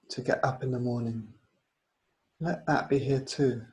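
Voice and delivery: unhurried yoga nidra guidance; guided meditation. Real, not acted. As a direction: guide them gently inward, leaving space between phrases.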